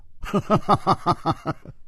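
long, breathy, falling chuckle; amused laugh. Acted. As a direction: laugh at your friend's predicament. 楚留香取笑朋友. (乐呵呵地笑一串)

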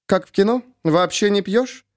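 high, mocking question; sarcastic. A narrator's reading. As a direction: let the sarcasm drip. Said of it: "отчётливо слышался сарказм". (с сарказмом)

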